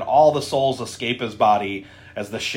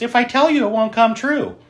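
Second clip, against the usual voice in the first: higher, light playful voice; imitated coy heroine; 9 semitones higher than usual. Host voices heroine answering her boyfriend's wish question, high imitation. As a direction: lift it up like a teasing sweetheart. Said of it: "And she goes".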